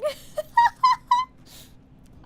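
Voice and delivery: long, high, loud burst of laughter. Real, not acted.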